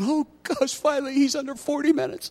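high, airy mock-relieved churchgoer; mock relieved listener. Congregant relieved the sermon is short, mock sigh. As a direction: play the bored listener's relief. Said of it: "I know some of you are going".

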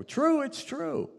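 high, loud, drawn-out agreement; comically emphatic, sing-out admission; mock exclamation. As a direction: imitate a delighted concession, stretching the words. Retells laughing agreement with wife.

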